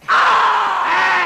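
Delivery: loud burst of discovery; surprised shout. Acted. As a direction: shout out the find. Finds the letter A.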